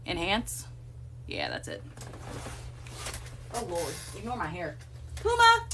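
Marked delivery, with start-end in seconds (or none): 5.2-5.7 high sing-song call to the cat; calling out to Puma. Real, not acted. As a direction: call him over, pitched way up.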